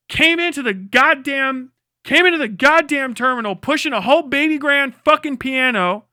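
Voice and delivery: normal talking voice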